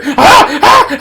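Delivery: rising hammed-up yelps of pain; mock pained cry. Patient at Mad Goro's dental chair, mock pained cries. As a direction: screech like a tooth being yanked. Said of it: "you hear".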